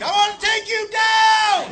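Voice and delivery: high, drawn-out war cry; mock battle scream. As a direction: imitate a furious charge, stretching the words. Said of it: "and he screamed".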